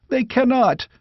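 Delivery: put-on high, despairing young voice; anguished lament. A narrator's reading. Squire laments the rout before the king, high anguished voice. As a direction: mourn the lost battle aloud. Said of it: "cried the squire".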